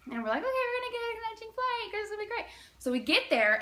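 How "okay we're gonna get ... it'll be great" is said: high, rushed chirp; mock-cheerful group voice; naive optimism. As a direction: chatter it fast and high, all hopeful.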